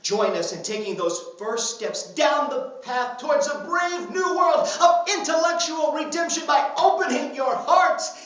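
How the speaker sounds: loud, rousing revival sermon; high-energy tent preacher, building to a shout; mock evangelist preaching. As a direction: proclaim salvation to the masses with hammed-up fervor. Mock preacher appeals to congregation.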